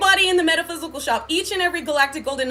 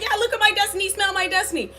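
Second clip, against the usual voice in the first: higher-pitched, eager retold offer; naive excitement; 6 semitones higher, faster than usual. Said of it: "'cause I was excited".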